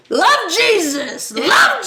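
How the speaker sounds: high, slow chanting preacher; street preacher. Retold or imitated. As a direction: impersonate a street evangelist. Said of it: "people who stand outside Petco Park".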